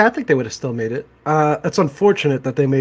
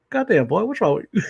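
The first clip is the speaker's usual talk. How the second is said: higher, exasperated scolding; exasperated old guy; 6 semitones higher than usual. Retold or imitated. Old guy scolding the boy in a higher exasperated voice. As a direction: scold him like a fed-up old man. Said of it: "He's like".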